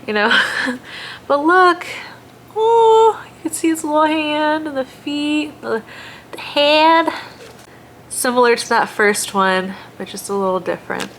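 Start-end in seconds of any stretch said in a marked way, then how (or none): 0.1-0.3 excited. Retold or imitated. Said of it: "'cause I'm like". 1.3-7.1 showing viewers the ultrasound, overjoyed and high; delighted gasp. Real, not acted. Pointing out baby's hand and feet.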